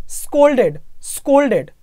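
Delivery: स्कोल्डेड with a clear long ओ, not ई; scolded with the O sound. Shown as a demonstration. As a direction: say स्कोल्डेड as स्-को-ल्-डेड. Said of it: "ये वाला sound लाना है". (स्कोल्डेड को ओ की आवाज़ के साथ बोलो)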